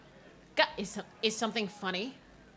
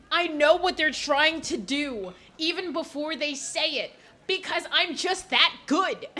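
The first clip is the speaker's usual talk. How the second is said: mock smug; 7 semitones higher, 5 dB louder, slower than usual. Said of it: "I'm that good at method acting".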